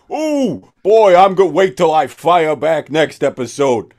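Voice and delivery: loud, high smug gloating; gloating mock troll voice, loud and high; mock taunting reviewer. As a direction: ham up a smug troll firing back. Mocking the reviewer's taunting reply.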